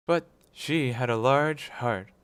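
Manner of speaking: ghost's voice. A narrator's reading.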